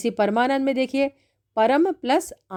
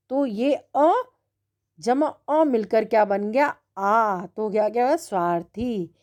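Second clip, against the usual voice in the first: ordinary talk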